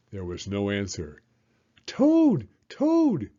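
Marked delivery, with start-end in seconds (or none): 1.9-3.3 high, loud shout of a frog. Acted. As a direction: call his friend loud and high. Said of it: "shouted Frog".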